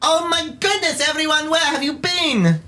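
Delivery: very high, squealing commotion; Ilio. Acted. Ilio greets everyone back at the hall, high excited squeal. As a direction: cry out in a tiny high-pitched character voice. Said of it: "to the sound of Ilio's commotion".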